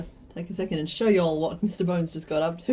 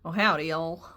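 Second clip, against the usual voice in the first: slow hammed-up Southern cowboy drawl; southern accent; slower than usual. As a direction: play a proud Southern Pluribus. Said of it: "remember he's southern".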